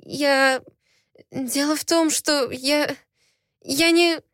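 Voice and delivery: stammering in confusion; flustered stammer. A narrator's reading. Heroine stammers, flustered under his gaze. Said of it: "окончательно растерялась я".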